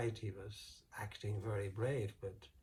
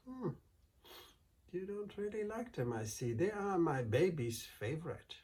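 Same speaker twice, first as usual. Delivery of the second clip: high, sugary, innocent voice; sweet innocent wife; 7 semitones higher than usual. Acted. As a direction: play it sweet and harmless to fool him. Wife teasing the giant.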